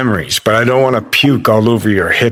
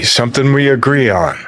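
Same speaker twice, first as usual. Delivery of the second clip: ordinary talk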